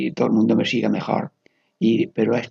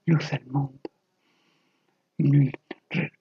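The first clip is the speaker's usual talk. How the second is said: meditative prayer; 4 semitones higher than usual. Real, not acted. Leading listeners through Ignatian prayer word by word, meditative prayer.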